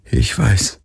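leise, traurig; tearful. Acted. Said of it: "Tränen verschleierten seine Sicht".

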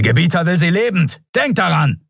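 high, forceful call; shouted order. A narrator's reading. Attacker commanding fellow fighters in battle, shouted order. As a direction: shout a reminder to your troops.

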